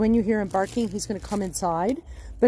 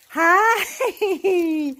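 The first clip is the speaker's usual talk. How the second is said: loud, high laughing greeting; excited laughing hi; 9 semitones higher, 7 dB louder than usual. Real, not acted. Trainer greeting the dog as he runs in, laughing loud. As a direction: burst out with a happy laughing hi.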